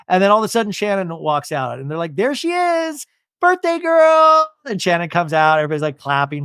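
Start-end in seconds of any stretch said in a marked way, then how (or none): 2.2-4.5 high, drawn-out mock squeal of greeting; group cheer. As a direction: imitate friends cheering the birthday girl. Group greets birthday girl.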